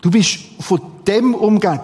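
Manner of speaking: usual speaking voice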